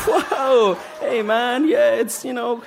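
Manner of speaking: high-pitched, put-on protest; flustered Spider-Man. Spider-Man answering kids who called him fat, flustered and high. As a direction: stumble through a high-voiced comeback.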